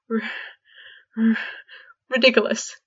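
stuttered, halting spell; stammering. A narrator's reading. George failing the spell against Fred, stammering. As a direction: force the word out through fear. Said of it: "tried George".

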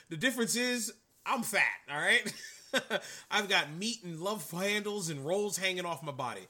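laughing at himself while talking; chuckling through self-mockery. Real, not acted. Laughing at himself being fat.

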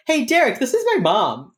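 mock-bright cheerful hello; over-cheery intro. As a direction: chirp it like nothing is weird. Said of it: "And then Reed just goes".

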